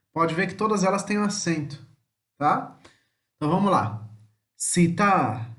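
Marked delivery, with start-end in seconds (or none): 4.6-5.5 Italian "Città", last syllable strong; final-syllable stress. Shown as a demonstration. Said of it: "Sempre a última sílaba mais forte". ("Città" com a última sílaba forte)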